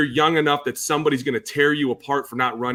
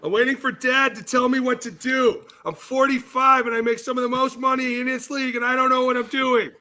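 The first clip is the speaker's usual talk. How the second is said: higher, whiny put-on voice of a lost quarterback; mocking imitation; mock helpless Kirk; 10 semitones higher than usual. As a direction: play a grown man waiting for Dad's orders. Mocking Kirk waiting on coach.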